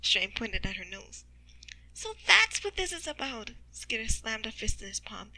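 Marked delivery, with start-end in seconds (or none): 1.9-3.5 high, louder burst of understanding; sudden realization. Acted. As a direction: pitch it up as it clicks. Skitter realizes what happened.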